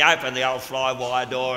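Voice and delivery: ordinary talk, nothing marked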